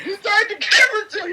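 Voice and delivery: high, fast, hammed-up wail; mock outraged scolding. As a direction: cry out the accusation in a hurry. Mock scolding repeated over camera.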